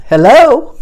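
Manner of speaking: high, loud mock disbelief; incredulous hello. Wife retelling her husband's stubbornness, loud high mock disbelief. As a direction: act stunned he won't listen.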